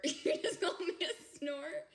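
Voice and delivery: laughing through the question. Real, not acted. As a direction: ask it while cracking up. Laughing at a snort joke.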